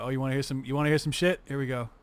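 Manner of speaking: normal talking voice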